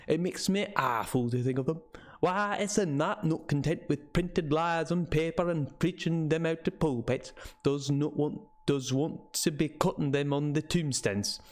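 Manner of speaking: put-on old local man's northern English with Scots touches; rustic northern English, slipping toward Scottish; northern English dialect. Old man scoffing to visitors about warnings, northern English dialect. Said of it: "like a very northern English".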